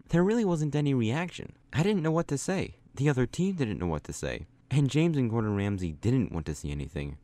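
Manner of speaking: ordinary delivery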